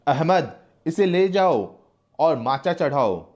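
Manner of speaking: loud, high barked command; harsh order. Acted. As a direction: order the torture at full voice. (कैदी को ले जाने का ऊँचा, ज़ोरदार हुक्म)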